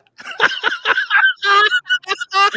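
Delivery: long squealing laugh; high laugh. Real, not acted. Laughs at own joke.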